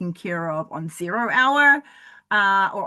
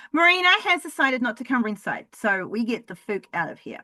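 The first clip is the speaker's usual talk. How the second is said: ordinary talk, nothing marked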